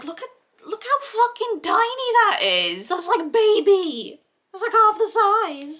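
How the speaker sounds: very high, loud squeaky squeal; excited squeal. Real, not acted. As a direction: squeal it like cooing over something tiny. Squealing over a tiny palette pan.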